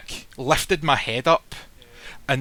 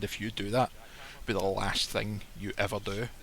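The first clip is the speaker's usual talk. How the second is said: quiet threat; 4 semitones lower, 9 dB quieter than usual. Retold or imitated.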